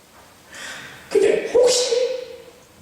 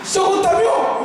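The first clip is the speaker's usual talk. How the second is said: higher, louder teasing question, laughing; mocking taunt; 7 semitones higher, 4 dB louder, slower than usual. Shown as a demonstration. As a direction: needle them mockingly. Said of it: "그러면 이렇게". (높고 크게 놀리듯 묻는 말투)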